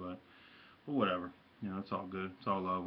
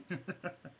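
short laugh; 10 semitones higher, 5 dB quieter than usual. Real, not acted. Laughs at own teasing.

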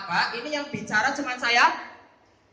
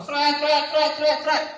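quick repeated swish noises, raised pitch; imitated pen strokes; 4 semitones higher, 4 dB louder, slower than usual. Teacher mocks underlining every verse, imitated pen swishes. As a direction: mimic frantic underlining with high pen-stroke sounds.

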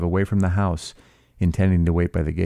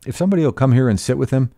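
fast, higher, curt command; authoritative snap; 6 semitones higher, faster than usual. A narrator's reading. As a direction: bark it out fast to get obeyed. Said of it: "he snapped authoritatively".

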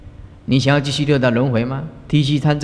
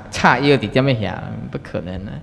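ordinary talk

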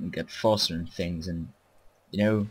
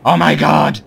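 high, very loud panicked yell; real startled gamer shout; alarmed shouting; 8 semitones higher, 14 dB louder than usual. Real, not acted. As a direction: sound the alarm as the attack hits. Enemy attack arrives in game.

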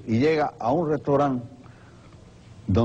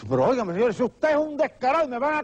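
acted outrage climbing high in pitch; comic alarmed accusation; indignant theater owner; 10 semitones higher than usual. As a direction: scold the swindler in a high, frantic voice. Theater owner confronts swindler.

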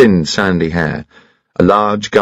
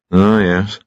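ordinary delivery

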